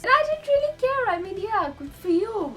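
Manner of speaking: high, airy I-don't-care voice; mock-haughty shrug in the voice; sassy dismissal. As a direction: brush him off like a bored teen. Dismissive teen attitude about celebrity.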